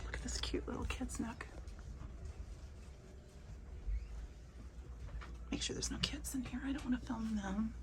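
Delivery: hushed whisper in a quiet shop. Real, not acted. Vlogger showing viewers round a quiet bookshop, whispering. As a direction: keep it very soft, barely voiced. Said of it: "I'm whispering 'cause it's quiet".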